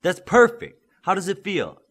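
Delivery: high, loud delighted praise; pleased coach. A narrator's reading. Coach Connor praising Midas, loud and delighted. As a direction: cheer him on.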